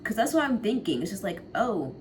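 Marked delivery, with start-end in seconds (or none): none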